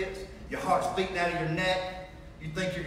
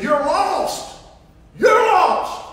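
much higher and louder each time; retold shout, rising to a yell; shouting accusation; 12 semitones higher, 12 dB louder, much slower than usual. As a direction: hurl it at the therapist, building. Tells therapist he's lost.

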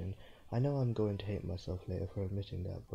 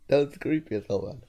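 loud creeped out; 5 semitones higher, 10 dB louder than usual. Real, not acted. After creepy scene.

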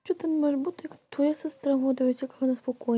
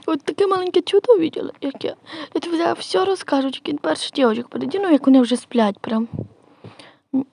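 loud play voices; 8 dB louder than usual. Pretend. Loud doll-family scene voices.

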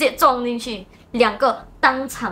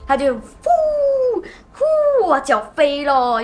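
a long stretched whoosh sweeping high, like a speeding motorbike; stretched high whoosh of a bike flying past; engine whoosh imitation; 4 semitones higher, much slower than usual. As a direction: let the whoosh glide up and hang slowly.

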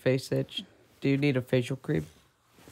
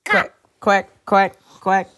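loud, higher quack quack quack quack; loud mock quacking; 5 semitones higher, 9 dB louder, slower than usual. Parent quacking loudly for the baby, playful. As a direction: honk out the quacks like a duck.

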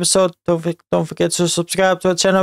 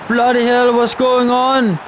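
exasperated outburst; 6 semitones higher, 3 dB louder than usual. Real, not acted. Match getting out of control.

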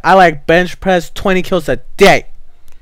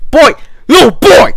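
calling out loudly; 7 semitones higher, 5 dB louder than usual. Real, not acted. Calling out to a kid on voice chat.

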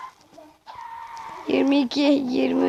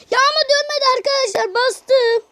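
frustrated protest; 9 semitones higher than usual. Real, not acted. Protests the game didn't respond.

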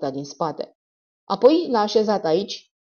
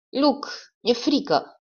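put-on higher, slow little-girl voice; childlike voice; 6 semitones higher, slower than usual. A narrator's reading. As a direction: speak like a frightened child, slowly. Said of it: "cu o voce de copil". (voce de copil speriat, mai înaltă și rară)

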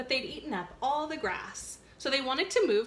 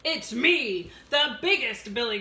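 loud booming big goat; roaring goat; 7 dB louder than usual. Acted. Biggest goat roaring back at the troll, loud. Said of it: "roared the big goat in a great big voice".

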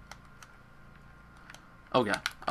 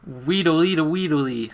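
SpongeBob cartoon voice; 5 semitones higher, slower than usual. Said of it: "That is a SpongeBob reference".

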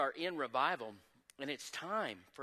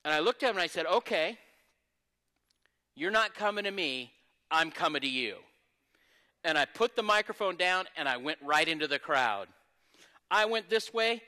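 loud, high, driving sermon delivery; preaching testimony; 9 semitones higher, 12 dB louder than usual. Acted. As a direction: preach it to the rafters. Testimony preached at full force.

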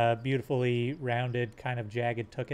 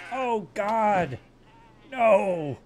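distressed aww; 6 semitones higher, 7 dB louder, much slower than usual. Real, not acted. Baby goat getting killed.

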